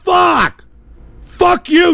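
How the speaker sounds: yelled curse at a troll in chat; furious shout. Real, not acted. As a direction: explode at the insult. Streamer explodes at a viewer's insult.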